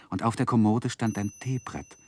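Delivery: ordinary delivery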